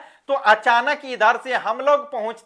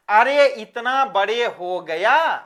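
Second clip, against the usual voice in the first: usual speaking voice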